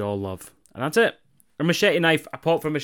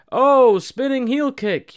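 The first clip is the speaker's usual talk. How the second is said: much higher, louder, slow sneering mimic; mocking commentators; 13 semitones higher, 8 dB louder, slower than usual. Wrestling fan mocking AEW commentators naming a move, high sneering mimic. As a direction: lampoon the bland announcer call. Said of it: "them going like".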